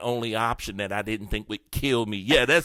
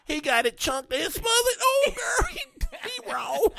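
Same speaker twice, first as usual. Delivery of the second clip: shrieking gossip. Retold or imitated. Waitress gossiping about his order, squealing high.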